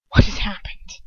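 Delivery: breathless fearful whisper. A narrator's reading. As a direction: whisper it, tight with fear. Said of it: "his voice little more than a whisper".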